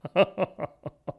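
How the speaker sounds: a scornful laugh at the offer; villain laughs off the detective's offer, mocking.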